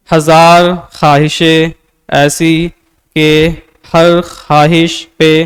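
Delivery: slow, plain prose-style reading. Shown as a demonstration. Teacher showing how not to read a poem, as plain prose. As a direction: read the verse flatly like ordinary speech. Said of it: "अगर इसको मैं नसर के अंदाज से पढ़ूं".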